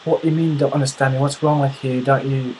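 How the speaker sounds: mock an exasperated Brit firing off questions; put-on British accent, worked up; British, flipping out. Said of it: "British guy flips that".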